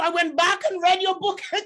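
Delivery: real laughter breaking into the words; laughing. Real, not acted. Laughing while retelling own story.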